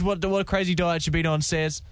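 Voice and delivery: ordinary talk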